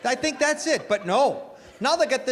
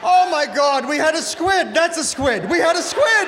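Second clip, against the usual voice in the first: squid shouted high and loud with laughter; mock shock; 6 semitones higher, 6 dB louder than usual. Father mistaking afterbirth for a baby, loud mock shock. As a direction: yell it in mock disbelief.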